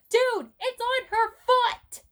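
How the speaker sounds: high, loud alarmed shout; a father shouts to his friends that the vehicle is on his daughter's foot; retold frantic shout. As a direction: yell urgently that it's on her foot. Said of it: "my dad goes".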